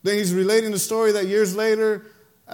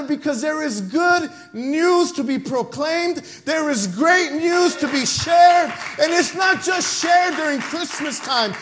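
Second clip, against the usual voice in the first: louder, higher, slow fervent proclamation; full-force preaching; 8 semitones higher, 7 dB louder, slower than usual. Acted. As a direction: build into a shouting gospel climax.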